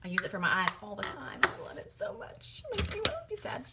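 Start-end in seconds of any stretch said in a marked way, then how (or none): 1.4-3.7 high, strained near-crying voice; near tears. Real, not acted. Vlogger mourning her discontinued favourite blush, near tears. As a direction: squeak it out as if trying not to cry. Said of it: "I'm gonna try not to cry".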